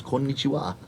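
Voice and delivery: quiet, low deadpan konnichiwa. Retold or imitated. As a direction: drop it flat and small. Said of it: "All I said was".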